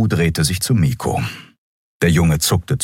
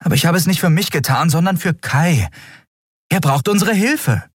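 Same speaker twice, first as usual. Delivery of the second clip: much higher, urgent woman's voice; earnest heroine; 13 semitones higher than usual. Acted. Captain defending her choice for Kai, urgent and high. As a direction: plead the case in a high, pressing voice.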